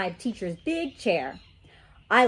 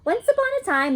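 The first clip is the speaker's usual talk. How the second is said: storytelling opening; 13 semitones higher, 4 dB louder, faster than usual. Acted. Starts made-up story.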